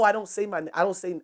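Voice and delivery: ordinary delivery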